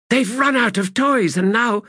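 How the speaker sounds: high, urgent character voice; hissed. A narrator's reading. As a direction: press on in a hissed, very high pitch. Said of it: "hissed Stalock".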